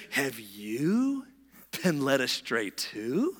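slow mocking question, swooping up at the end; mock them with drawn-out, rising words. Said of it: "They mocked".

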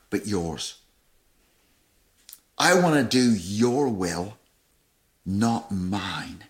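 slow, low, pleading; earnest prayer. Acted. Preacher voicing Jesus praying to God, slow earnest plea.

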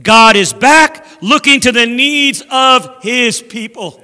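slow, emphatic proclamation, loud at the start; calling out. Acted. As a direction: call the news out to a crowd, drawing it out. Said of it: "they started calling out among themselves".

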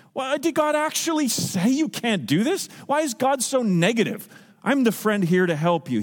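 soft-voiced tempter. Preacher voicing the serpent to Eve, mock friendly. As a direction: coax her like a false friend, lightly acted. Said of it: "He speaks in a soft voice".

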